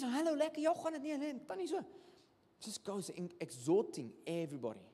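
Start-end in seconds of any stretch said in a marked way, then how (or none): 0.0-1.8 much higher, bubbly friendly greeter voice; imitating Joey greeting. Preacher imitates his friend Joey greeting everyone, high and bubbly. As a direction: greet every person in the room with glee.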